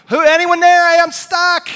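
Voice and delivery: loud, high shout for help; calling out at full voice, pitched high; hollering. Acted. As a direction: holler up from the cliff, stuck. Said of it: "he's hollering, he's hollering".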